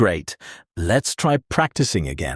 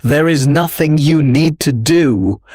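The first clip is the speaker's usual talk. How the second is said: soothing sleep-learning narration; relaxation guide; 4 semitones higher, 6 dB louder than usual. Acted. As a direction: ease the listener into letting go. Leads a relaxation script.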